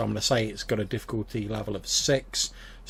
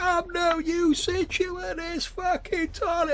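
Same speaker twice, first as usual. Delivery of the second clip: put-on drunk old man; slower than usual. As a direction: roar it like a sloshed old family friend. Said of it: "he's like, he's like".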